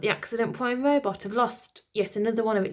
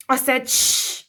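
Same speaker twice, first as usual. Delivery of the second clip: cross insistence; 5 semitones higher, 5 dB louder, slower than usual. Acted. Nanny insisting.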